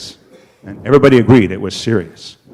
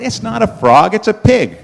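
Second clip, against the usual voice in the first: high-pitched mock protest; insistent frogs; 8 semitones higher than usual. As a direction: set the raccoon straight, voice raised in pitch. Frogs correct the raccoon.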